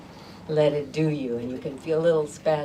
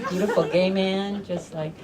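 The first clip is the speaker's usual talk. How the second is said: ordinary talk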